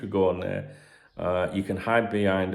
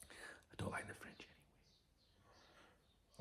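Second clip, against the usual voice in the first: cheeky whisper; whispered aside; 26 dB quieter, faster than usual. Real, not acted. Podcaster sharing a naughty opinion with listeners, whispered. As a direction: whisper it as though it's not allowed. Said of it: "Am I allowed to say that?".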